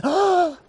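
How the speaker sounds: a snake's squeal of fright; frightened gasp. Acted. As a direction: gasp as if the Gruffalo looms behind. Snake sees the Gruffalo.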